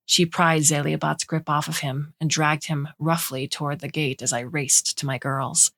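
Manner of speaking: usual speaking voice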